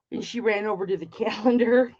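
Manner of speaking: ordinary talk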